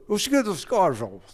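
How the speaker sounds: high, garbled mock Scottish burr; unintelligible Scottish accent. Scottish cop talking to baffled driver, garbled Scottish accent. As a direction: imitate a Scottish cop nobody can understand. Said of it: "Like, this English accent".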